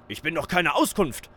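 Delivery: scharf und laut angeblafft; angefahren. Acted. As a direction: genervt und laut anfahren. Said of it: "fuhr er sie an". (laut und gereizt angefahren)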